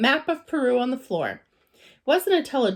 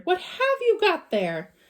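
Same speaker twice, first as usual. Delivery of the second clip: startled; 8 semitones higher than usual. Acted. Mrs Bird startled by bear.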